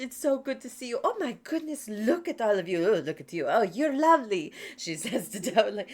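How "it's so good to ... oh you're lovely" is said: high, loud, welcoming shopkeeper; cheerful cat shopkeeper welcoming customers; bright cheerful voice. Acted. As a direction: throw the door open and greet them gladly. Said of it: "a bright, cheerful voice".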